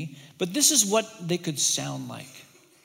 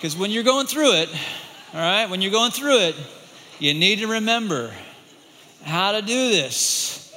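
loud, driving sermon emphasis; emphatic preaching; 2 semitones higher, 6 dB louder than usual. Acted. Preacher urging the congregation, loud and emphatic. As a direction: press each phrase home to the congregation.

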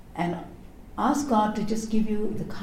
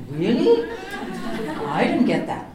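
higher, louder, laughing incredulous Really; laughing disbelief; 11 semitones higher, 4 dB louder than usual. Pretend. Preacher mocking a far-out reading, laughing disbelief. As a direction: laugh out a baffled Really as if the meaning was lost. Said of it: "you have to think".

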